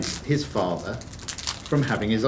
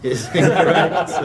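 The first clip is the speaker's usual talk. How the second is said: laughing through the verdict; 3 semitones higher, 4 dB louder than usual. Real, not acted. Quizmaster laughing at a silly wrong answer. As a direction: giggle it out, louder.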